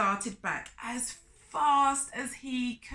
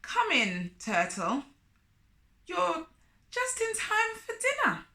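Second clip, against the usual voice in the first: sly spider, sighing welcome; a put-upon sigh of welcome; a lightly voiced Anansi, reluctant host; 7 semitones higher than usual. A narrator's reading. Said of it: "Anansi sighed".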